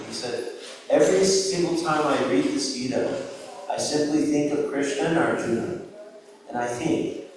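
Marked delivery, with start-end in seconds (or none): none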